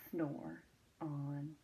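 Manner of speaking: very soft, fading whisper; soft sleepy ending. A narrator's reading. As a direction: let it drift off to sleep. Closing refrain.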